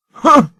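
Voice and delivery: loud, high, scornful grunt; a sharp grunt of contempt. A narrator's reading. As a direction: huff it out in disgust. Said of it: "grunted Sir Handel".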